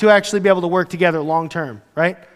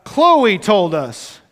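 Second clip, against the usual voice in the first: very slow, drawn-out reveal; milk the reveal; dramatic reveal; much slower than usual. Retold or imitated.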